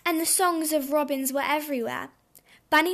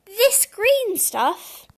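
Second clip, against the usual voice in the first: loud, high, very slow disbelief; bunny's big incredulous squeal; astonished question; 7 semitones higher, 7 dB louder, much slower than usual. A narrator's reading. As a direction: stretch each word in amazement. Astonished bunny asks about grass.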